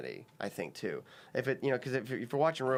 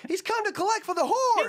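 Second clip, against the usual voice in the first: extremely high, loud, put-on voice; guest's voice caricature; 8 dB louder than usual. Comedian mimicking the guest's line from the parody, shrieky high caricature. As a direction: blare the line way up in falsetto. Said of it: "your voice goes".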